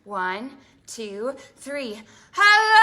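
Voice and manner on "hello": loud hello. Shown as a demonstration. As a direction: shout it out high and loud. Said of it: "Let's say hello as loud as we can".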